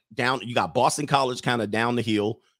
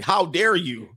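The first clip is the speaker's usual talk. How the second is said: imitating offended locals, pitched up; mock indignation; 5 semitones higher, slower than usual. Host voicing Boston people at a forgotten college, mock indignation. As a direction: act affronted on behalf of Boston. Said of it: "They're like".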